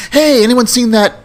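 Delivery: high-pitched acted topic change; forced casual interjection. Hostess changing the topic at dinner, high-pitched and put-on. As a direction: pipe up to derail the argument.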